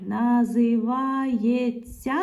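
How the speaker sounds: slow "називається", each syllable held. Shown as a demonstration. Teacher reading back the word with є inserted, drawn out. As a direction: stretch НАЗИВАЄТЬСЯ out for spelling.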